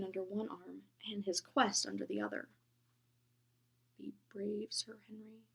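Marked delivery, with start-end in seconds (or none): 4.0-5.4 soft, whispered encouragement; whispered echo. A narrator's reading. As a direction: breathe it gently.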